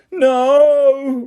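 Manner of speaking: high, sharp yell of panic. A narrator's reading. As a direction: cry out as the car dives. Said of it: "Ron yelled".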